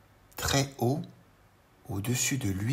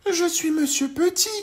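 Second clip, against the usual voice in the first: very high, louder little voice; tiny Monsieur Petit; 7 dB louder than usual. Acted. Tiny man greets the worm, very high voice.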